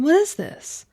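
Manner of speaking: thrilled to find cake waiting for her; delighted surprise. Acted.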